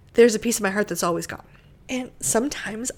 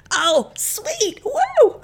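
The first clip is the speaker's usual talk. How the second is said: high, loud whoop of joy; excited cheer; 12 semitones higher, 4 dB louder, much slower than usual. Retold or imitated. Wife reliving husband's approval, joyful high whoop. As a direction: cheer like you just won.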